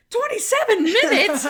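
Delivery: high, loud shocked question; stunned by the stopwatch; Monk reading the stopwatch in shock; shocked disbelief. Retold or imitated. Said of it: "he's like".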